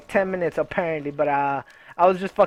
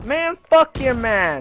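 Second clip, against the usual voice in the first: slow, drawn-out cocky brush-off, pitched higher; mock bravado; 6 semitones higher, 3 dB louder, slower than usual. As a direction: play the smooth guy dismissing her boyfriend, hammed up. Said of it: "I would've sounded fucking cool".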